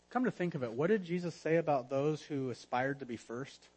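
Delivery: ordinary talk, nothing marked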